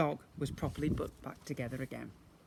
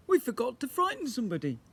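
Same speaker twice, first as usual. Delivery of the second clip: higher, louder remembering; a lightly voiced skeleton realizing; big skeleton; 8 semitones higher, 4 dB louder than usual. A narrator's reading.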